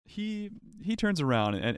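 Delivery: usual speaking voice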